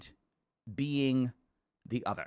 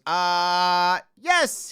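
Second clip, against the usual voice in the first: retold game show yell; 2 semitones higher, 7 dB louder than usual. Randy on the game show blurting out his answer, loudly. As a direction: shout the answer like a contestant. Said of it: "He s-, yells the N-word".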